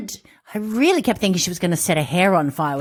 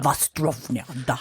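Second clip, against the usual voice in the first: a lightly mocked Russian accent, softer; half-hearted fake Russian toast; dodgy Russian accent; 7 semitones lower, 6 dB quieter than usual. Mocking the professor's accent, dodgy Russian. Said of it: "dodgy Russian accent".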